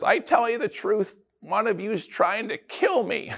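high, dramatic aunt voice; mock-solemn aunt at a holiday meal; Aunt Irma's announcement. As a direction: play the aunt making a grand, ominous pronouncement. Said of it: "Aunt Irma stands up and says".

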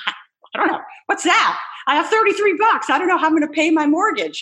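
mock-panicked high voice; retold panic. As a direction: blurt it out in a comic fluster. Retold panicked answer to marketing question.

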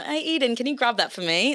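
put-on asking Eden a favour in a British accent. Singer acting out her awkward British accent habit. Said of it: "gabbing away in British accents".

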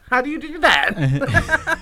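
high, slow, through laughter; real giggles stretching the words; laughing. Real, not acted. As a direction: ask it while cracking up. Laughing over miming a word.

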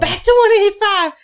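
high loud teasing repeat; mocking echo. Mocking echo of friend's weight.

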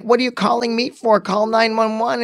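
high, urgent retold demand; exasperated. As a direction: re-enact an exasperated phone reply. Retold exasperated reply in emergency call.

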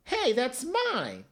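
put-on lightly high, slow little kid; a hint of a whiny child; mild kid voice protesting; Caillou voice. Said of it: "Caillou was like".

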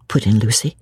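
plain reading voice

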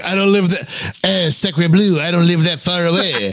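hammy Québécois tough guy; french accent. Retold or imitated. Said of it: "he's like".

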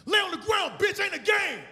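high-pitched robber's command; forceful, high-voiced audition read. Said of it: "all right, do the lines".

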